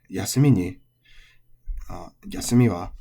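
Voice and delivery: yasumi ni, yasumi wa rising to a high final mi; final-high pitch accent. Shown as a demonstration. Teacher modelling tail-high yasumi for learners. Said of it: "which is odaka. So final high".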